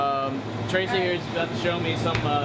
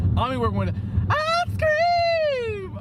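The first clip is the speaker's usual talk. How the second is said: excited; slower than usual. Real, not acted. Thrilled about new ice cream job.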